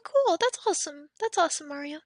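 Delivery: ordinary talk, nothing marked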